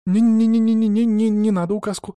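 high, loud rattle of refusals; hasty backtracking. A narrator's reading. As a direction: take it back at once in a high voice. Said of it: "мигом переменила она своё мнение". (поспешно идёт на попятную, высоким голосом)

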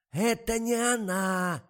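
очень громкий высокий крик; кричит глухой старухе; надрывается. A narrator's reading. (надрывный громкий крик)

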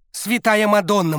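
high fervent appeal; praying coach. A narrator's reading. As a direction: plead to heaven. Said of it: "молился тренер".

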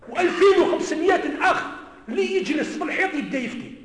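loud, high indignant shout; furious preacher's outcry; shouting rebuke. Real, not acted.

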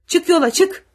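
high, loud command; soldier's sharp shout; shouted order. A narrator's reading. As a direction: yell at him to get moving. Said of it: "diye bağırdı uzun olanı".

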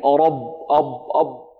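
رب with the ب thickened like the ر; dark, heavy stop on the ب of رب and أب; wrongly heavy ba. Shown as a demonstration. Teacher showing students the mistake, thickened-ba demo. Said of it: "من تفخيم الباء أن تتأثر بالراء".

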